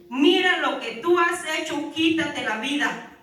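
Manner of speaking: mock devil taunting her to end her life; devil's voice. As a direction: hurl it at her like a tempter. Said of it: "ese es el diablo".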